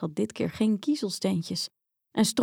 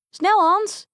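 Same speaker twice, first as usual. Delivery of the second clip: much higher, louder urgent shout; urgent cry; 11 semitones higher, 5 dB louder than usual. Acted. Grietje shouts at Hans as the house collapses, high and urgent. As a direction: hurry him now. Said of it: "riep Grietje".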